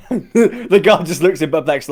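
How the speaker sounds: laughing. Real, not acted. Game master laughing as he describes the guard's reaction, laughing narration. Cracking up at the joke.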